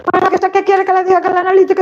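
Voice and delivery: high, rapid-fire grumpy doctor; imitated grouchy doctor. Grouchy doctor to a nervous patient about test results, high rapid impatience. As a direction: rattle it off as an irritable, impatient doctor. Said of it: "siempre me toca un cascarrabia".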